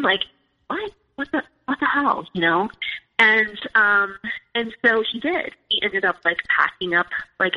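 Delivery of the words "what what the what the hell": raised, incredulous what the hell; recalling her stunned reaction, pitched up; retold disbelief. Retold shock at husband's plan.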